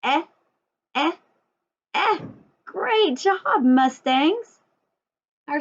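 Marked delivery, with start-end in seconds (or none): 0.1-2.2 "Eh" als kurzes, offenes e; short e sound. Shown as a demonstration. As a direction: model "Eh" for the kids to copy. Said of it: "Can you make that sound?".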